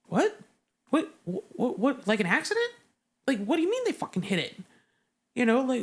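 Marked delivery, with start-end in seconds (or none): 0.1-4.6 higher, fast, stunned questions; imitating shocked sailors asking what happened; disbelieving. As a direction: blurt out confused disbelief. Sailors reacting to news.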